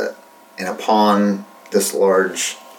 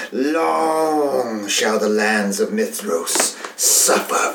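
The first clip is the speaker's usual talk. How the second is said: slow, menacing villain monologue, half whispered; a freed demon queen threatening revenge; megalomaniac rant; slower than usual. Acted. Villain Sazul speaks her monologue.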